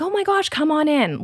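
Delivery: high-pitched, eager acted welcome; welcoming greeting. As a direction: usher in a dear visitor, voice lifted. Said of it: "Let me show you how I'm gonna greet you".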